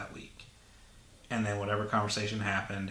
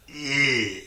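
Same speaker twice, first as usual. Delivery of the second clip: louder, higher mock grunt; fake grunt; 4 semitones higher, 6 dB louder than usual. Shown as a demonstration. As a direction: grunt like a sleazy villain. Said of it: "fake fingering grunt".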